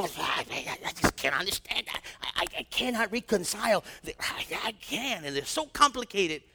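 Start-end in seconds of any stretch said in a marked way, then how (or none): 0.0-5.2 a hint of a stammering, flustered skeptic, softer; flustered intellectual. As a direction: stumble over the words like someone who can't reconcile faith. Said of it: "And they go".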